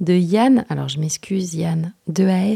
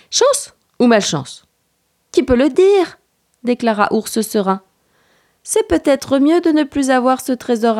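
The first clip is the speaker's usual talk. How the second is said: animated storytelling, pitched up for the dialogue; children's story reading; 5 semitones higher, 7 dB louder than usual. A narrator's reading. The reader tells of Petit garçon and Ours, animated story narration. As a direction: read a picture book with lively suspense. Reads picture book aloud.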